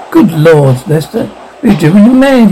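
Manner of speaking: ordinary delivery, nothing marked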